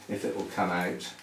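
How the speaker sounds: ordinary delivery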